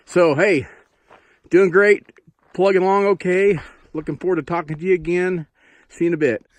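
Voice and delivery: bright, high and loud; upbeat sign-off. Real, not acted. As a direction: cheer them on as you sign off. Cheery sign-off to viewers.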